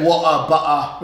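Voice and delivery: water and butter with dropped T's, British; working-class British accent. Retold or imitated. Teacher imitating gangster-film talk, working-class British accent. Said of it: "working class sort of like British accent".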